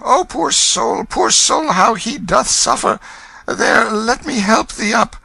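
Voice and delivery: very high, fussing, slow pity; kindly gentleman. Acted. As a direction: coo over the sufferer. Stranger pities Hugo.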